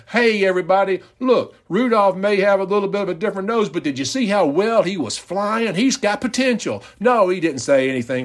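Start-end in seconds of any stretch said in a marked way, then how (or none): 0.1-6.8 high, fast proud call to the crowd; rally everyone behind the kid; encouraging announcement. Pretend. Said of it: "Or does he say something like".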